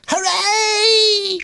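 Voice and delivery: put-on very high, loud baby-animal cheer; excited squeak. A narrator's reading. Baby beaver cheering Philip on, squealing. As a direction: squeal it out, stretched and gleeful. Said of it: "bounced up and down ... and squeaked".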